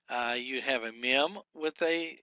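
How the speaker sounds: usual speaking voice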